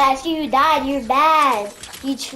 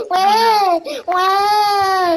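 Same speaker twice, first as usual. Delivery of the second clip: high, louder mock wailing like a baby; mock baby crying; 7 semitones higher, 3 dB louder than usual. Taunting an opponent who died in the game, mock baby wail. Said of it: "crying like a little baby".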